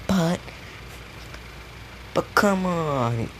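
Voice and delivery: high, slow, drawn-out whine; whiny pleading. Retold or imitated. Handler begging the hunter to let her capture the furry bat, whining high and slow. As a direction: beg like a sulking kid.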